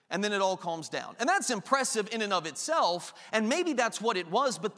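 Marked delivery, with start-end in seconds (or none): none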